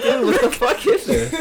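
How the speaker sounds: laughing outburst. Retold or imitated.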